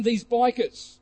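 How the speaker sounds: ordinary talk, nothing marked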